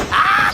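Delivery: short loud high cry; high startled scream. Real, not acted. Player caught off guard, high loud scream. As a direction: yelp in surprise.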